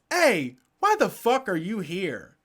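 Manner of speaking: mock an indignant woman demanding an answer; imitated angry challenge. Woman calling from her car window at protester, confrontational.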